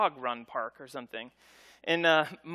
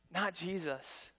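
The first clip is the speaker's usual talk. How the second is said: ordinary delivery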